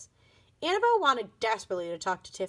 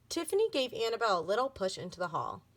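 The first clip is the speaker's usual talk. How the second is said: usual speaking voice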